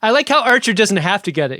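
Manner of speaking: usual speaking voice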